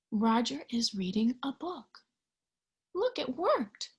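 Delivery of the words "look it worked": ordinary delivery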